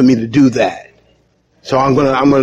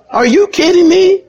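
incredulous; 13 semitones higher than usual. Real, not acted. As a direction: ask it in mock disbelief. Incredulous about hope in politics.

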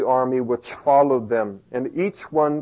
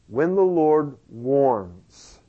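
slow emphasis; much slower than usual. Real, not acted. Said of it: "slow emphatic sermon line".

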